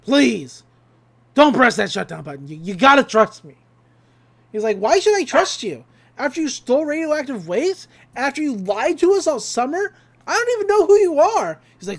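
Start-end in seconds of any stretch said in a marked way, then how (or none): 0.0-3.6 loud plea. Retold or imitated. Stan pleading with Dipper. 4.8-11.6 angry confrontation. Retold or imitated. Dipper confronting Stan.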